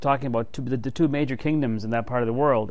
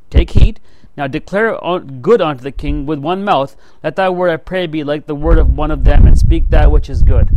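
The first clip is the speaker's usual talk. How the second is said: louder, pressing the prophet to fall in line; pushy messenger; 8 dB louder than usual. Retold or imitated. Messenger urging Micaiah to agree, loud and pushy. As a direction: lean on him, insistent and loud.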